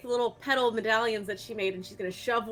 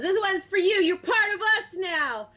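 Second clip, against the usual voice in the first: bright call, higher and louder; cheerful toss; 9 semitones higher, 4 dB louder than usual. Acted. Helvis throws a medallion to Freebird, calling out cheerfully. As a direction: toss the gift with a happy shout. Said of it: "chucks one over at him".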